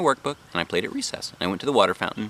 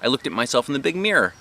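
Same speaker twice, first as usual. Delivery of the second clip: ordinary delivery, nothing marked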